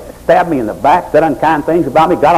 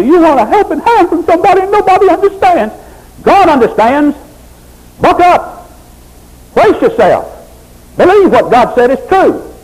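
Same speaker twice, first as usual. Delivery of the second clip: sermon at full pitch; fiery preaching; 5 semitones higher than usual. Real, not acted. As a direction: preach it hard to the congregation.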